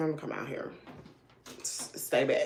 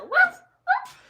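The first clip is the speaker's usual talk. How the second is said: high, loud yappy puppy bark; imitated dog bark; 8 dB louder than usual. Recounting the lost puppy barking back, a high yapping bark. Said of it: "I heard him bark".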